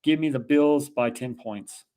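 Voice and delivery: normal talking voice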